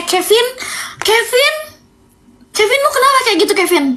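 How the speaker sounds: high, rising calls of the name; scolding call-out. Real, not acted. Calling out her friend's boyfriend, high scolding disbelief.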